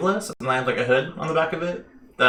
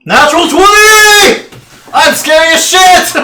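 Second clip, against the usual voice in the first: screaming with joy, high and loud; excited shout; 16 dB louder, slower than usual. Real, not acted. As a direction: yell it out in triumph. Celebrates natural twenty roll.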